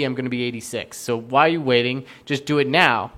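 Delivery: normal talking voice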